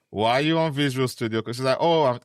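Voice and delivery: ordinary talk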